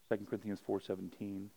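ordinary talk

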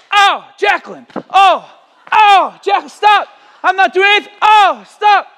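hammed-up shouting to fool mom downstairs; fake cries for help. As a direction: yell like your sister is hitting you. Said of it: "I began to make some slap noises".

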